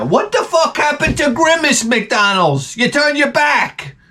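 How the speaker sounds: high indignant rant at McDonald's; betrayed fan scolding a brand; mock outrage. Real, not acted. Fan scolding McDonald's, high and indignant.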